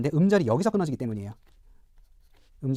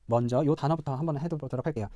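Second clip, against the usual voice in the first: normal talking voice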